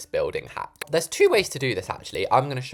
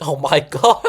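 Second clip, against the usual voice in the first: laughing; 2 semitones higher, 5 dB louder than usual. Real, not acted. Laughs at the posh voice.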